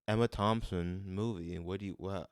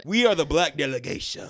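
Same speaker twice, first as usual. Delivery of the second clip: grand declaration; 7 semitones higher, 11 dB louder than usual. Pretend. Proud grand declaration, joking.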